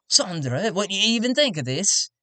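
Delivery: tactless tradesman. Acted. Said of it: "he looks over at Sandra and he goes".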